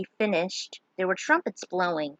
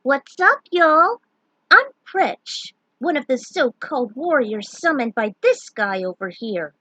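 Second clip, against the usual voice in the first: much higher, louder perky greeting; delighted; 5 semitones higher, 7 dB louder than usual. A narrator's reading. Elven girl greeting the newcomers, delighted and perky. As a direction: bounce in with cheeky delight. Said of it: "She beamed with delight".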